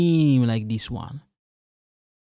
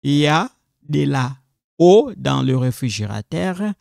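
ordinary talk, nothing marked

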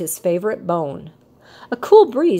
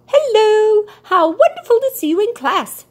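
high, louder, bright bird greeting; chirping bird; 13 semitones higher, 4 dB louder than usual. A narrator's reading. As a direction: chirp a happy hello to a pupil. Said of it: "she chirped".

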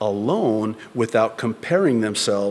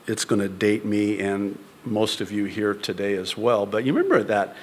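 ordinary talk, nothing marked

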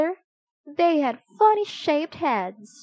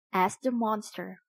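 usual speaking voice

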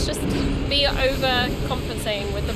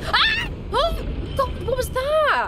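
startled shout; 12 semitones higher, slower than usual. Real, not acted. Jump scare in a horror game.

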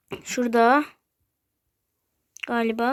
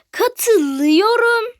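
loud, high, very drawn-out syllables; dictating; 7 semitones higher, 5 dB louder, much slower than usual. Shown as a demonstration. Streamer showing viewers what to type, emphatic dictation. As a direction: stretch it out slowly and emphatically.

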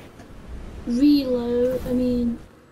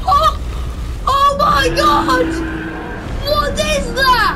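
excited screaming; 5 semitones higher, slower than usual. Real, not acted. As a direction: yell in amazement at what appears in the sky. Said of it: "I'm sorry if I'm screaming".

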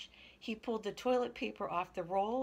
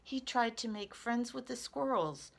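usual speaking voice

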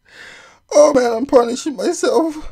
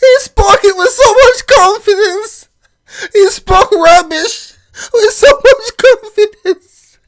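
higher, crying with laughter; laughing; 8 semitones higher, 4 dB louder than usual. Real, not acted. Viewer marveling at the man's confidence, crying laughing. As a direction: squeeze the words out through laughter. Laughing fit after clip.

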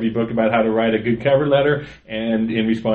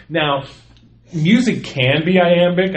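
ordinary delivery

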